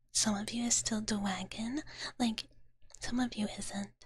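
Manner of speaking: usual speaking voice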